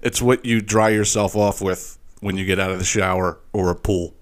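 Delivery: normal talking voice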